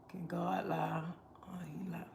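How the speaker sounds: hushed, breathy aside; whisper. Real, not acted. Whispering a doubtful aside to her viewers. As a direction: drop to a whisper as if sharing a secret. Aside questioning God's honesty.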